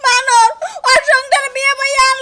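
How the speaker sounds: loud, tearful wail; crying. Acted. Wife lamenting her married life, loud crying. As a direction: wail through sobs. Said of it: "কাঁদিস কিসের নিগে".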